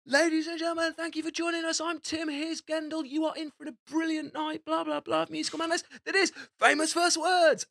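high-pitched hyped-up MC patter; mock-shouted show opener, high throughout; shouted stage welcome. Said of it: "And I shout".

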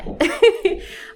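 high, loud chuckle; real amused little laugh; short laugh. Real, not acted. Author wry about adults justifying themselves, laughing.

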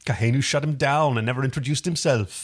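bad Welsh accent drifting Jamaican; Welsh accent attempt. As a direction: try a Welsh lilt, miss it. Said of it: "why do you suddenly have a Jamaican accent rather than a Welsh".

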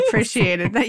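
usual speaking voice

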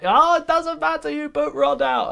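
high-pitched, dubious Dutch impression; mock Dutch accent. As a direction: protest in a badly faked Dutch accent, way up high. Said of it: "excuse the Dutch, I don't know what that accent is".

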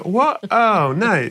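high-pitched, hammed-up dismay; mock shock. Friend told of a death on the grill, mock-shocked. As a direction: react with big put-on surprise, pitch leaping up. Hears the husband is dead.

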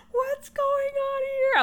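high, slow, squeaky bewildered question; freaking out. Retold or imitated. Staring at comments on the phone, squeaky bewildered. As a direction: stretch it out, squeaking in disbelief. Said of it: "I was freaking out".

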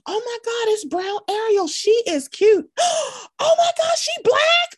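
high, gasping mock squeals of delight; imitating excited kids. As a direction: act out thrilled little girls screaming at a screen. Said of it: "get excited, jump around, scream".

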